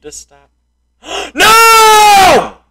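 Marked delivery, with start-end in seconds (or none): 1.3-2.6 sharp gasp then high, loud wail of no; gasp and cry of dismay. Real, not acted. Gamer shouting as his character falls. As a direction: cry out as it all goes wrong. Falling in game.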